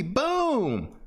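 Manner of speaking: long cry of boom sliding high then falling; high drawn-out boom. Shown as a demonstration. Teacher making his favorite trade noise, long and high. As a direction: let the boom ring out like a cry. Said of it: "making and hearing that noise".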